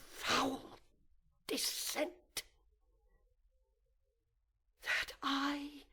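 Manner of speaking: disgusted whisper. Acted. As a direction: whisper it high in horror at himself. Villain's self-disgust in soliloquy.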